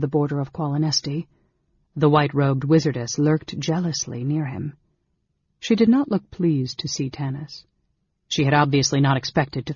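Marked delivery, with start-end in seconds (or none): none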